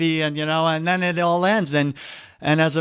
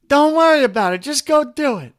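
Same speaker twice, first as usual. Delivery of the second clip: much higher and louder, brushing him off; mock preacher shrugging off the question; mocking dismissal; 11 semitones higher, 7 dB louder than usual. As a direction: toss it off like a glib preacher.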